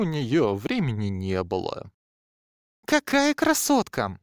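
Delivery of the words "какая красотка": high, loud delighted gasp; admiring. A narrator's reading. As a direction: gush over the guitar. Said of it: "Восхищённо произнесла блондинка".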